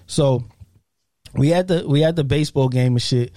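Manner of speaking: ordinary talk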